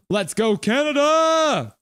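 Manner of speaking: high, loud, stretched-out slow cheer; cheering shout. Real, not acted. Fan streamer rallying for Canada, loud drawn-out cheer. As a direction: belt it out like a fan in the stands.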